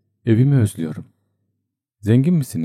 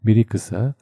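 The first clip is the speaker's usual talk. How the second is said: ordinary delivery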